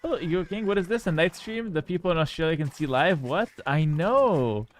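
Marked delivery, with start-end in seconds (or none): none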